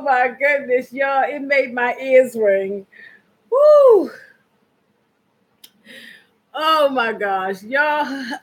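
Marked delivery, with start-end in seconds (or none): 3.5-4.1 high, loud woo after laughing; high whoop. Real, not acted. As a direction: let out the laughter. Recovering from laughing fit.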